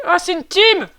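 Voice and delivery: crié très fort et aigu; dépité, hurle au sort; cri de frustration. Real, not acted. Tombe sur une équipe. (cri de rage dépitée)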